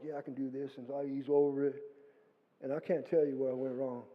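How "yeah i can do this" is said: softer yeah, I can do this; quiet self-talk. Retold or imitated. Picking a path over the edge, quietly. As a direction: reassure yourself under your breath. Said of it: "I'm like".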